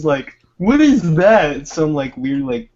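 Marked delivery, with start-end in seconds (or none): 0.6-1.6 slow, raised, louder question; drawn-out wondering question; curious surprise. Retold or imitated. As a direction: point at something strange in the woods. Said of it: "my cousin sees".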